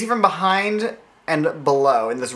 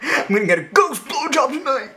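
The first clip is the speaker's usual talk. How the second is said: higher, laughing douchey guy impression; a light mock douchebag, higher; 9 semitones higher than usual. Cohost riffing on a haunted movie, higher douchey guy voice. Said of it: "douchey guy voices".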